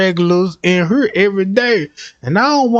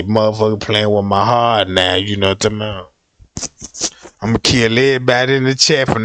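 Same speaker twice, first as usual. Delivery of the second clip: mock menace; 10 semitones lower than usual. Mock threat, heartbroken.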